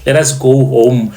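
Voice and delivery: plain reading voice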